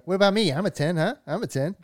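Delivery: imitated pushy small guy; eager little guy. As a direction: pipe up, fishing for approval. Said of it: "then that, that little guy".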